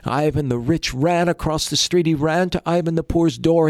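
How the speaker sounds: ordinary delivery, nothing marked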